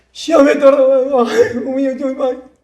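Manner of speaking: very high, drawn-out imitated sobbing; mock wailing. Preacher plays someone who failed an exam, mock wailing. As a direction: wail in an exaggerated high voice.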